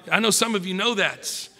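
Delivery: usual speaking voice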